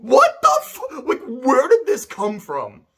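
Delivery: high, slow, laughing through the words; laughing disbelief. Retold or imitated. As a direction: sputter it out, laughing in shock. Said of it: "I'm like".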